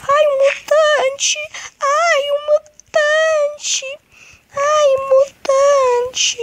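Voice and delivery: very high, slow wailing voice; high whiny cry. Acted. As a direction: wail it out like a crying cartoon. Mutant attacking in game.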